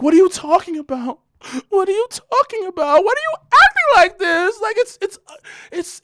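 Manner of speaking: high-pitched upset girlfriend imitation. Imitating his girlfriend denying it, high and whiny. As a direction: mimic her, high and whiny. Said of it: "She's like".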